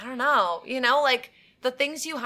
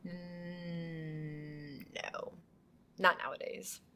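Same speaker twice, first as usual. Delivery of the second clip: much softer, barely voiced refusal; whispered no; 3 semitones lower, 14 dB quieter, much slower than usual. Real, not acted. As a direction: breathe the answer quietly. Answering hitchhike question.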